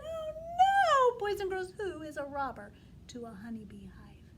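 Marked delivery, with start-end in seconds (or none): 0.0-1.2 alarmed gasp. Acted. Reacting to the robber in story.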